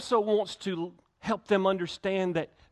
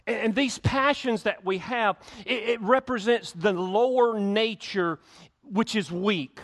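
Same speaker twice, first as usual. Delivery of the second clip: fervent preaching; 4 semitones higher, 4 dB louder than usual. Acted. Preaching at rising force.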